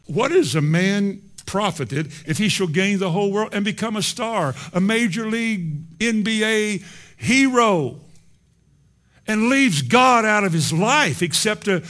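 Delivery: high, loud pulpit delivery; forceful preaching. Real, not acted. Preacher on gaining the world without God, forceful preaching.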